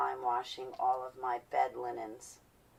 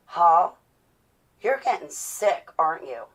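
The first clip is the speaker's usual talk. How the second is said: imitated accusation; 2 semitones higher, 10 dB louder than usual. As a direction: call someone out loudly, acting it out. Retold confrontation at the firehouse.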